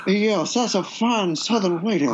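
savoring, put-on Georgia accent; Savannah, Georgia accent. Shown as a demonstration. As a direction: play a genteel Savannah Southerner. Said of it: "I can kinda do a Georgia".

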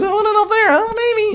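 very high, hammed-up dithering; imitated husband. Husband pointing wife to seats, high mock dithering. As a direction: pitch it up in a mock seat-hunting dither.

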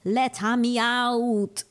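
put-on slow Australian accent; drawn-out Australian accent. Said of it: "trataré de hacer un acento australiano".

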